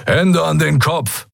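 "Hände" hit firmly, falling, a stern order; pirate demanding hands up, slow and stern. A narrator's reading. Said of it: "forderte ein dunkelhäutiger Mensch".

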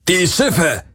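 high, alarmed call; a cry of alarm from inside; shouting from the house. A narrator's reading. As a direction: yell it from a distance. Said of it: "hörte er jemanden im Haus rufen".

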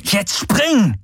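high, strained acted shout; urgent order. A narrator's reading. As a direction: insist through clenched teeth. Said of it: "Er biss die Zähne zusammen".

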